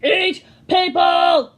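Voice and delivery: loud, slow put-on monster voice; monster roar. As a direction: roar it hungrily. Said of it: "roared the sea monster".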